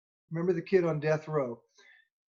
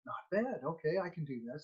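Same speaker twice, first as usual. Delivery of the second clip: put-on tentative kid; 8 dB quieter than usual. Kid accepting the job of carrying stuff, quietly tentative. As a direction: think it aloud quietly as the kid. Kid relieved at being given a task.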